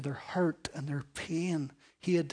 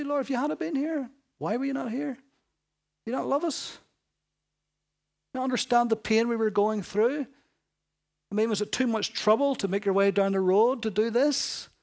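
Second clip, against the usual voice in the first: higher, pleading reproach to the Lord; imagined grieving sister; 6 semitones higher, 3 dB louder than usual. Retold or imitated. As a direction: voice the sisters' hurt complaint. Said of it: "they must have been thinking those things".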